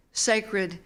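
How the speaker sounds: ordinary delivery, nothing marked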